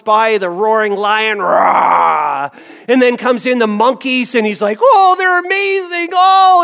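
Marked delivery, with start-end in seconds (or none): 1.4-2.5 a loud, growling lion "rah"; lion roar. Retold or imitated. 4.8-6.6 much higher, loud, delighted squeal; thrilled child. Retold or imitated. Boy thrilled by monkeys.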